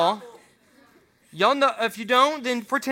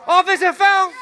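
mock foul call; 8 semitones higher, 7 dB louder than usual. Calling foul in pretend game.

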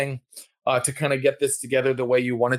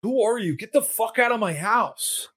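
angry; 8 semitones higher than usual. Retold or imitated. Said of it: "he's like".

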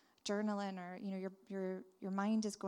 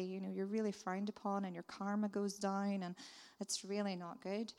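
usual speaking voice

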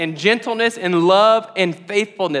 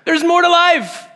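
higher and louder, laughing through it; amused, louder quoted Jesus; 10 semitones higher, 4 dB louder, slower than usual. Retold or imitated. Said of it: "He's like".

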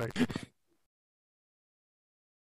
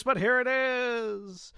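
fighting game announcer; 8 semitones higher, much slower than usual. Pretend. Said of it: "I've gone into an announcer voice".

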